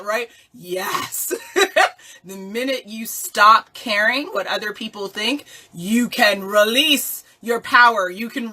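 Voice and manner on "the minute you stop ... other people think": ordinary delivery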